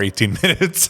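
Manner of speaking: normal talking voice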